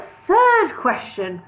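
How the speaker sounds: high, loud, slow scorn; curt dismissal. Acted. Elf lord dismissing Bingo, high and slow. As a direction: sneer it out slowly. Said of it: "snapped Elsker".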